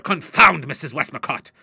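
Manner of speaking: loud, exasperated curse; angry cry. A narrator's reading. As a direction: burst out at the very name. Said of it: "he cried".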